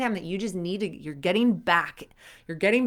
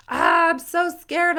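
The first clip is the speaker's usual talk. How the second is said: high, slow, wavering fake fright; mock scared whimper; 11 semitones higher, 8 dB louder, slower than usual. Gymnast panicking after a fall, mock scared and high.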